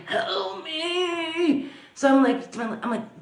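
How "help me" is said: higher, softer, strained call, held calm; calm strained plea. Retold or imitated. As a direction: imitate a hurt man calling help me calmly but strained. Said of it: "literally very calm and, like, strained".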